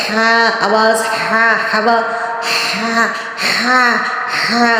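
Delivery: ہا breathed firmly from the throat; clear throat ha. Shown as a demonstration. Teacher demonstrating the throat sound. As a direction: push ہا out of the throat. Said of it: "حلق سے proper ادا کرنا".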